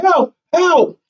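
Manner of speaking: high-pitched mock yell for help; imitated yelling. Soldier lost in the thicket calling out, imitated yelling. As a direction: re-enact a call for help, pitched high without full volume. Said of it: "I'm yelling help".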